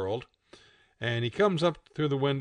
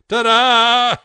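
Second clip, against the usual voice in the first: high, loud, long-held showy cry; triumphant reveal; 11 semitones higher, 14 dB louder than usual. A narrator's reading. The world shows Pig its drawn smile, triumphant. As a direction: sing it out like a stage flourish.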